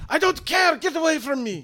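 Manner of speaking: high, loud, panicked snapping; frantic angry outburst. Acted. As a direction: drive the intruder off in a panic. NPC shouting player away.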